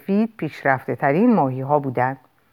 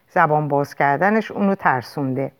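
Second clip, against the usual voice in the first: ordinary delivery, nothing marked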